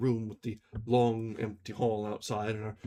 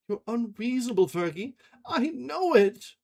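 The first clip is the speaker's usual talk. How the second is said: sobbing; 11 semitones higher, 5 dB louder than usual. A narrator's reading. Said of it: "Ferguson sobbed".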